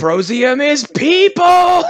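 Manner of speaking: slow, high, loud parody cry; hammed-up Soylent Green outcry; mock dramatic shout. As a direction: bellow it like a doomed movie hero.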